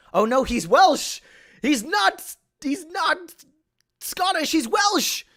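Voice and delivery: high-pitched, loud panic at a mistake; dismayed outburst. Real, not acted. Player realises the character is Welsh not Scottish, high dismayed outburst. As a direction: cry out in alarm, voice shooting way up.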